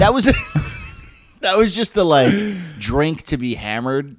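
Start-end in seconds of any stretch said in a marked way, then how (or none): 0.4-0.9 short shrieking laugh; a real quick laugh at a memory; high laugh. Real, not acted.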